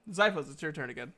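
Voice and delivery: ordinary delivery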